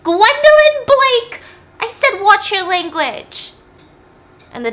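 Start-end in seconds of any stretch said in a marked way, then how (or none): 0.0-3.5 loud, high, drawn-out scolding yell; full name called out high, then a slow reprimand; stern scolding. Acted. Parent scolds Gwendolyn for her language, loud scolding.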